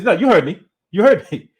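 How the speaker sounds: insistent retort. Retold or imitated. Blunt host to a startled caller, insistent. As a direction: repeat it firmly, pressing the point.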